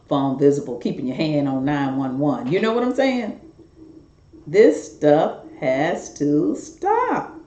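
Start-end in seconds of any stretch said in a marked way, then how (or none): 4.5-7.3 very slow, every word set apart, "This stuff has to stop."; slow, weighty "stop" after spaced-out words; emphatic plea. Real, not acted. Emphatic plea against trafficking.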